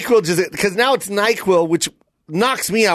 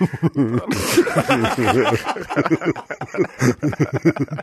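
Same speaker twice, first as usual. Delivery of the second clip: low, dragged-out drowsy laugh; slow motion laugh; 3 semitones lower than usual. Retold or imitated. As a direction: laugh like it's slowed down. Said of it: "He even laughed slow motion".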